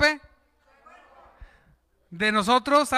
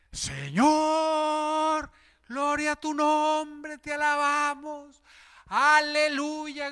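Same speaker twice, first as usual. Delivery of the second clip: higher, slow, hushed praise; mock devout worshipper; praising voice; 5 semitones higher, much slower than usual. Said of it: "Dígale, hermano".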